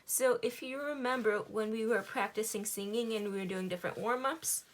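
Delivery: ordinary delivery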